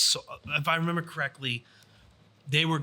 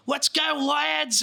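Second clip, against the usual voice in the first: hammed-up British accent; 12 semitones higher, slower than usual. British player rallying airsoft teammates to charge, in a hammed-up British accent. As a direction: play a British player cheering the team on in a mock accent. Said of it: "his really thick accent".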